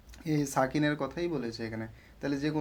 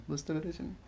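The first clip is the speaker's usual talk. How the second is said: ordinary talk, nothing marked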